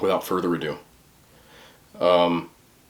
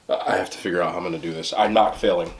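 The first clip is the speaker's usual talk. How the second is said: ordinary talk, nothing marked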